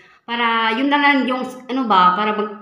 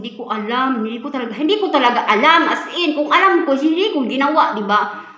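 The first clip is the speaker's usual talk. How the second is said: defensive protest; 3 semitones higher, 6 dB louder than usual. Retold or imitated. Defending herself to the nurse.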